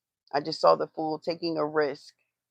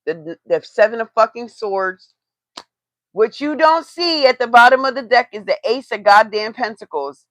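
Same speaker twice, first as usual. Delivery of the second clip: louder and higher, swearing at the cards; exasperated outburst; 6 semitones higher, 11 dB louder than usual. Real, not acted. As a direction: build to an exasperated rant. Rising frustration reading the cards.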